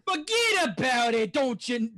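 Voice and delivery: hammed-up New York dismissal; Brooklyn accent. Shown as a demonstration. Impressionist asked for New York, broad Brooklyn accent. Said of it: "New York accent. Brooklyn accent".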